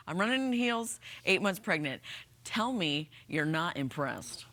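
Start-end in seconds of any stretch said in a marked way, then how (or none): none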